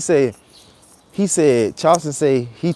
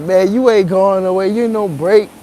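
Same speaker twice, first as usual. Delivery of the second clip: mock angry heckler; 8 semitones higher, 5 dB louder than usual. Heckler refusing his break.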